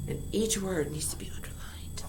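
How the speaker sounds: unvoiced whisper; whispered reminder. Real, not acted. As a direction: breathe it as a whisper. Whispered instruction.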